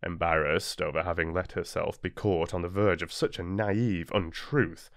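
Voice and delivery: ordinary delivery, nothing marked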